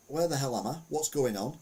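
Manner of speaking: ordinary talk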